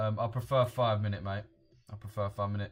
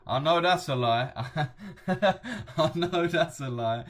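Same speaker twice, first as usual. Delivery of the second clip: loud, higher-pitched, breaking into real laughter; laughing disbelief; 7 semitones higher, 6 dB louder, slower than usual. Real, not acted. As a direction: call out the fib, cracking up. Calls out viewer, laughing.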